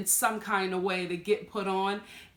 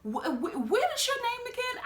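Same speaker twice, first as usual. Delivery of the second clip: much higher, incredulous questioning; mock confused; 12 semitones higher than usual. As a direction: act baffled by a stranger. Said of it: "I'm like".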